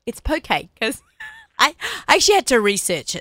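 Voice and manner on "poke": "poke" as POH-kay, two syllables; correct pronunciation restated. Shown as a demonstration. Host insisting after the wrong versions, "poke" as POH-kay. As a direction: land "poke" on a clear "ay". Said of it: "It's pronounced poke".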